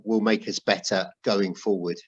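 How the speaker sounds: normal talking voice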